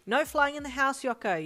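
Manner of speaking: loud, high telling-off; reprimand. A narrator's reading. Mother scolds her son for flying indoors, loud. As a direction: scold him firmly. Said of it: "you reprimanded him".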